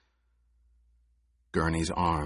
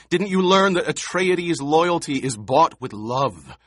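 loud, high scolding; rising rebuke; 9 semitones higher, 5 dB louder than usual. A narrator's reading.

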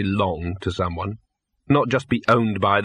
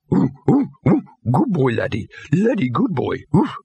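eager parroting dog; 5 semitones higher than usual. Acted. Dog Laddie parrots human words.

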